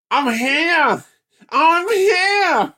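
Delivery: very high, squeaky mock hero arrival; mocking co-host's voice. Mocking his co-host arriving to rescue, very high squeak. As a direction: announce yourself like a tiny cartoon hero. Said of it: "like, you going".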